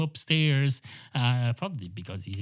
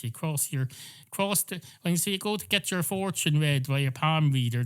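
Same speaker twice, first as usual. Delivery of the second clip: ordinary talk, nothing marked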